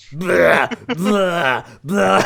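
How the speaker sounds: loud retching blahs; loud gagging noises, machine-gun fast; imitated retching. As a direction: mimic someone vomiting in bursts. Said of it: "kinda like a machine gun".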